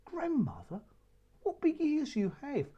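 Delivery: put-on high, wondering child's voice; little girl voice. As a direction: ask it like a wide-eyed little girl. Child questions the disguised wolf.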